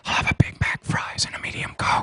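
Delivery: hushed, voiceless library whisper; comic stage whisper; whispered order. Acted. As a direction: whisper the fast-food order so nobody in the library hears. Librarian's reminder makes blonde whisper order.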